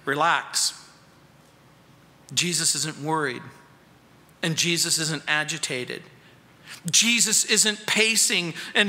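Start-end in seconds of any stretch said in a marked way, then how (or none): 0.1-6.0 reassuring. Acted. Preacher reassures congregation.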